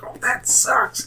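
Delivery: slow, soft whisper; whispered mock pity. Player eyeing an unarmed enemy, whispered mock pity. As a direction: draw it out in a whisper of fake sympathy.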